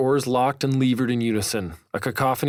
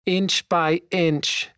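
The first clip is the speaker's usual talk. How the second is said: put-on much higher, slow coach in a British accent; genteel British accent; 7 semitones higher, much slower than usual. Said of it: "in his genteel British accent".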